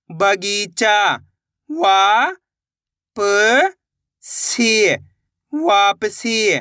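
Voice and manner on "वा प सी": वा, प, सी, each held on its own; syllable-by-syllable sounding out. Shown as a demonstration. Teacher models for beginners, वा, प, सी one at a time. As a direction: space out वा, प and सी, drawing each out. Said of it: "एक एक अक्षर का उच्चारण करके".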